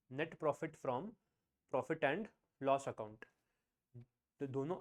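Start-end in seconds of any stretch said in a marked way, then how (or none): 0.1-3.2 very slow, one word at a time; slow dictation. Shown as a demonstration. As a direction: spell it out for their notebooks. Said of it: "write down". (धीरे-धीरे लिखवाना)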